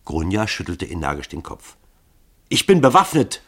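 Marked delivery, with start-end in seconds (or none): 2.5-3.4 laut und hoch drohend; drohend gerufen. A narrator's reading. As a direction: mit der Waffe drohen. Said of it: "rief sie drohend". (Grunja droht den Mördern laut)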